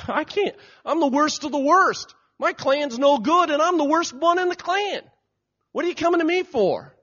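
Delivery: high-pitched, loud self-doubting protest; acted wail of self-doubt; protesting Gideon.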